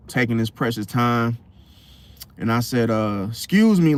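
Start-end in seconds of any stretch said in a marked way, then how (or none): 3.3-4.0 higher, louder Excuse me, losing patience; firmer excuse me. Retold or imitated. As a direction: demand he move this time. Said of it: "like that".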